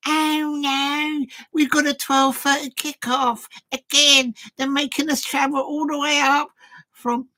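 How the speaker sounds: high whiny mock complaint; mock moaning Liverpool fan. Fan moaning about early kickoff, high mock whine. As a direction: whine like a grumpy away fan.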